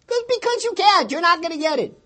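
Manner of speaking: high, loud taunting scoff; mocking big brother. Brother mocking his little sister's prayer, loud and high. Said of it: "bursts into laughter".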